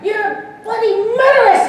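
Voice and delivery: high, loud, slow accusing cry; hammed-up horrified shout; mock outraged yell. Quoting co-star yelling at him.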